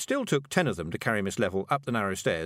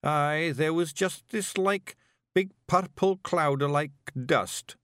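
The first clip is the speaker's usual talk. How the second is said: high, slow, halting Scots voice; dim Scottish Feegle; 6 semitones higher, slower than usual. A narrator's reading. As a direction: grope slowly for words as a daft Scot. Voices Daft Woolly, a Feegle.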